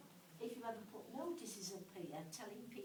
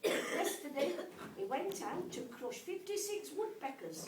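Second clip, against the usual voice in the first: higher, louder recital of a letter; comic letter reading; 4 semitones higher, 9 dB louder than usual. Acted. Mum writes a silly letter to her son, comic letter reading. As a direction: read it out line by line, pausing for each punchline.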